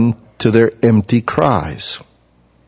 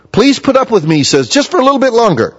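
high, eager voice, much higher; eager Elihu; 11 semitones higher, faster than usual. Acted. Preacher voices Elihu begging to keep talking, high and eager. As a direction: play the keen young man who won't stop talking.

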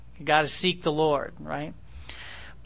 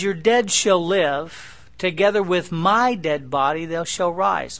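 louder, slow proclamation; solemn reading; 4 dB louder, slower than usual. Acted. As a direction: proclaim resurrection triumphantly. Reading scripture aloud.

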